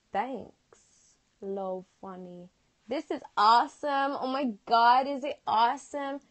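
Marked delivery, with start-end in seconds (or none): 2.9-6.2 loud, excited American gushing; higher, louder hammed-up American; American accent. Shown as a demonstration. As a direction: gush in a put-on American accent. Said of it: "that was a good American one".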